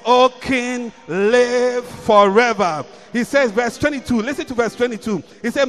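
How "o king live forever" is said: very slow, reverent reply to the king; slow drawn reply. Acted. Daniel answering the king from the den, slowly. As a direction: stretch it out with reverence.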